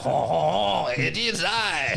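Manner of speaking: lightly voiced French accent, laughing; a grand French reveal. Laughing French accent answering the chat room. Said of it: "I talk with an accent".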